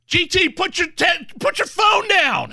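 loud mock-scolding yell, laughing; shouting. Yelling at friend to stop.

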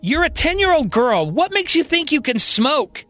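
high-pitched, incredulous telling-off; indignant scolding. Acted. Marion scolding Colby for smoking, indignant scolding. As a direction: scold like an exasperated parent, pitch up.

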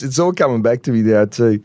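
ordinary talk, nothing marked

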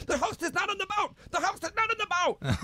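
very high-pitched, hammed-up panicked cry; mock panic. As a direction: imitate a frantic alarm going up in falsetto. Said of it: "they're all panicking".

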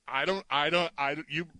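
ordinary talk, nothing marked

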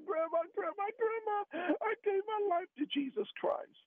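high tearful voice, telling grandma the news on the phone; crying on the phone. Retold or imitated. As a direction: retell it like he's choking up with tears. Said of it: "I'm crying on the phone".

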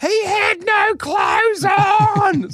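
very high, drawn-out mock woman's scream; hammed-up scandalised female shriek; feminine scream. A narrator's reading. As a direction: shriek it slowly in falsetto. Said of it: "a feminine scream".

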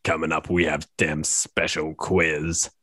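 ordinary talk, nothing marked

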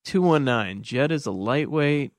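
ordinary talk, nothing marked

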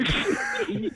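a high, cracking laugh through tears; high teary laugh. Real, not acted. Caller overcome on air, high teary laugh. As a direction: laugh that breaks into crying. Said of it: "I promised I wasn't gonna cry".

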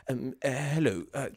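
quiet, halting nervous voice; timid and awkward, mumbling; nervous Eddie Redmayne. Pretend. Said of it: "learning my nervous voice".

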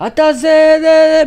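high, loud mock heckling; imitated screaming. Retelling a protester screaming at a politician in a restaurant, mock screaming. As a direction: mimic a heckler yelling at full pitch. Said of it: "צעק, התחיל לצרוח עליו".

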